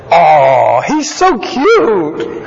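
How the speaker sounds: high, slow, dreamy lovestruck gush; swooning teen girl. Acted. Pharaoh's daughter gushing over baby Moses, swooning high voice. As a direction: gush like a smitten teenager over a boy.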